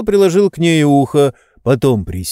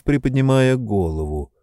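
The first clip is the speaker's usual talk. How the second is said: usual speaking voice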